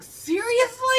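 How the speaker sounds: high, loud incredulous seriously; outraged at being left there; exasperated disbelief. Retold or imitated. Exasperated at father laughing.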